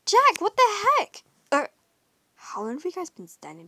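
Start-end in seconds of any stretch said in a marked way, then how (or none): 0.1-1.2 loud, higher startled cry, slowed; surprised what the heck; startled outburst. Acted. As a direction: caught off guard, call out in shock. Startled by friends watching.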